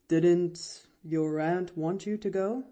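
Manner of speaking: lightly voiced doctor, mildly hesitant; a little diffident. A narrator's reading. Doctor driving the girl away, a little diffident. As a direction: ask with slight diffidence. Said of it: "a little diffidently".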